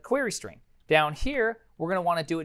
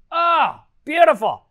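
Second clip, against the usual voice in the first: loud, soaring cry of delight; delighted exclamation; 12 semitones higher, 10 dB louder, slower than usual. Real, not acted. Coder sees the script work, delighted exclamation. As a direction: exclaim it high and loud, thrilled.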